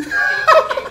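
sudden high-pitched cackle; real laughing fit; high burst of laughter. Real, not acted. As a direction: laugh hard at the friend. Laughing at friend's team.

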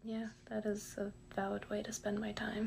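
defeated hushed whisper. Acted. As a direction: give in under your breath. Defeated self argument, whispered.

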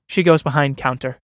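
ordinary talk

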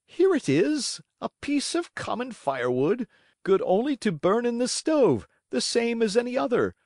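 a touch of fright, pitch climbing; frightened stammer. A narrator's reading. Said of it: "trembling and stuttering from fright".

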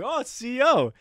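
high-pitched echo; high, exaggerated astonishment; mock surprise. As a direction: repeat it in comic realization. Retold surprised echo of answer.

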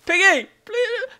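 high desperate cry; desperate plea. Real, not acted. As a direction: cry out pleading.

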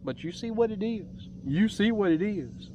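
ordinary talk, nothing marked

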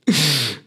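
short amused chuckle; real laugh. Real, not acted. Podcaster laughing at their own irregular posting, short chuckle. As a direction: let out a brief self-mocking laugh. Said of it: "Là, je rigole".

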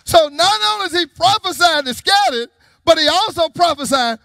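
high, loud preacher's delivery; full-force preaching. Real, not acted. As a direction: preach it out to the congregation.

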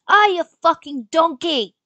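a posh British sneer; posh British accent. Retold or imitated. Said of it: "That sounds way too expensive".

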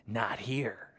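whispered crocodile line; sneaky crocodile. Acted. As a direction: hush it like a lurking croc. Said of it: "says Crocodile".

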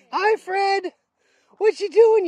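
high, loud happy pet voice; squealy hello to a big dog; excited dog greeting. Real, not acted. Greeting the basset hound.

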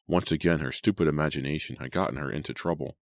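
plain reading voice

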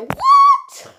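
high, loud shrieked what; shocked shout. Pretend. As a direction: scream it in total shock. Toy learns it was eliminated.

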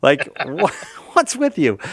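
high-pitched, laughing disbelief; mock incredulity. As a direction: protest in amused bafflement, pitch leaping up. Retelling incredulous reply to Australians.

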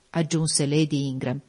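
ordinary delivery, nothing marked

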